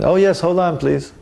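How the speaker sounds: high-pitched, polite phone voice; phone answerer. Retold or imitated. Temple devotee answering the phone, high and obliging. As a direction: imitate someone obligingly taking a call.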